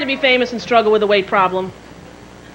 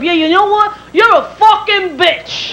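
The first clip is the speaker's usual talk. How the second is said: higher, drawn-out insult; mock angry fan; 6 semitones higher, slower than usual. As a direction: spit a slow insult as a spurned fan. Acted spurned fan turning abusive.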